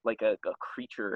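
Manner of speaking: ordinary talk, nothing marked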